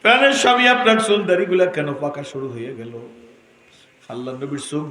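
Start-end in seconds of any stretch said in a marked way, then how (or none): 0.0-2.9 high, loud chanting storytelling; full-force preaching. Acted. Preacher voicing Aisha answering her husband, loud high cry.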